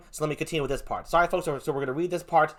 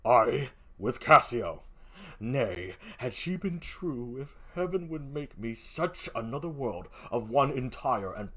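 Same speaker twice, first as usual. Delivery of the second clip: very low, slow, hushed speech; Othello, brooding; 4 semitones lower, 8 dB quieter, slower than usual. Acted. Othello speaks of his wife's betrayal, low and brooding. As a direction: dwell on it deep and quiet.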